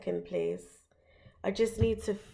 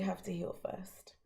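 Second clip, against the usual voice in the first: whispering; 11 dB quieter than usual. Real, not acted. Closes the reading in an aside.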